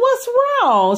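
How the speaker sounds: high, startled question; puzzled. Retold or imitated. Puzzled question to her disapproving mentor. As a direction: ask in sudden confusion.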